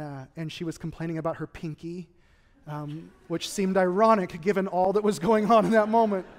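ordinary delivery

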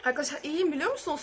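usual speaking voice

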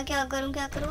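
usual speaking voice

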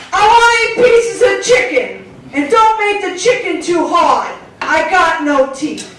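high, loud demanding phone customer; cranky caller. Retold or imitated. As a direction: order like a toothless complainer. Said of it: "she had a lot of problems".

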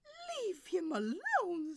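high, loud, drawn-out protest; protective soprano. Acted. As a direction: defend him like a diva. Soprano defends Luigi.

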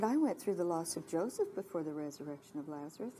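low, soft voice of an inner thought; retold gentle whisper. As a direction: quote it quietly, low and gentle. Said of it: "I heard a gentle whisper".

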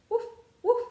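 high, bright-sounding yappy puppy woof; puppy bark. Acted. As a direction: bark like a little playful puppy. Dog barks in picture book.

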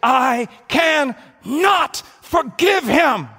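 slow, shouted, high-pitched fury; loud, drawn-out angry outburst; furious refusal. Acted. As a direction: shout each word with clenched-fist hatred. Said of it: "clenches their fists and say".

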